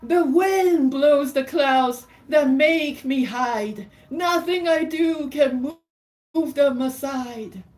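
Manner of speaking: high, loud, stretched-out verse; theatrical picture-book reading, pitch held high; dramatic story read-aloud. Acted. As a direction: declaim the rhymes at full voice. Reads verse dialogue at full force.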